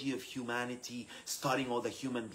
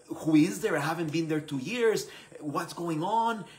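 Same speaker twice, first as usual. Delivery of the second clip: louder, higher flustered questioning; panicked self-doubt; 5 semitones higher, 6 dB louder than usual. Retold or imitated. New leader questioning himself after the appointment, flustered. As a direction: act out a rising flap of doubt.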